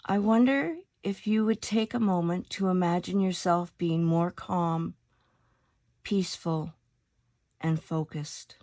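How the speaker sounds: calm meditation voice with long pauses between phrases; guided meditation. Acted. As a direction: lead them unhurriedly into stillness, letting each phrase settle.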